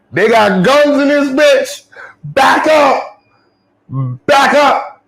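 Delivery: high, loud panicked cop yelling. Retold or imitated. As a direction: squeal it out like a terrified cop.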